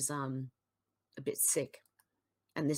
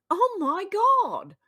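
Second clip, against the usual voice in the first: much higher and louder, slow drawn-out despair; exasperated disbelief; 11 semitones higher, 7 dB louder, slower than usual. Real, not acted. Commentator reacting to a tweet in loud exasperated disbelief. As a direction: cry out to heaven in disbelief.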